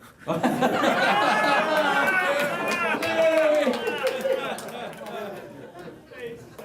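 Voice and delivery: long, loud high-pitched laughing fit; hearty laugh. Real, not acted. Speaker teased about being short on screen, loud laughing fit. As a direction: burst out laughing, high and long, then tail off.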